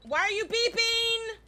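loud, high-pitched exasperated question; loud, fed-up outburst; exasperated yell. Real, not acted. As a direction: yell at the thing that won't stop beeping. Yelling at something that beeps.

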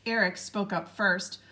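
ordinary delivery

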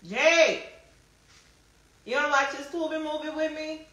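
high, carrying invitation; calling out. Real, not acted. Calls Jay to join the movie.

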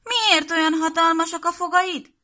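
put-on high, loud child's puzzled question; a little girl amazed at grandma's ears; wondering little girl. Piroska frightened at wolf's teeth.

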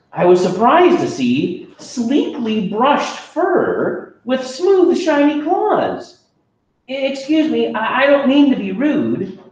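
put-on high, bright child narrator; a high kid voice, surprised and polite; young kid voice. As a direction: tell it as a wide-eyed kid.

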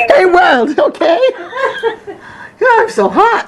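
high, fast, laughing confession. Real, not acted. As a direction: gush through the giggles, almost shouting. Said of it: "I confess".

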